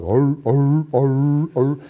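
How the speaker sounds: a lightly voiced low hound baying; bay it out like an old hound on a trail; low hound dog bay. Preacher imitating a hound dog starting on a coon trail, low baying. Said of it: "starts off with a real low voice".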